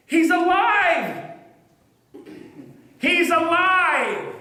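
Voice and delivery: overjoyed cry of the resurrection; joyful shout. Acted. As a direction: shout the news to everyone. She runs back shouting the news.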